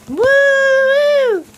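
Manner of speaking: high, loud woo; excited whoop. Real, not acted. Birds flying about.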